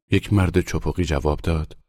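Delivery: ordinary delivery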